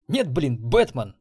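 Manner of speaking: loud sarcastic snap; irritated. A narrator's reading. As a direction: retort loudly, annoyed. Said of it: "раздражённо сморщился программист". (раздражённо и громко, с сарказмом)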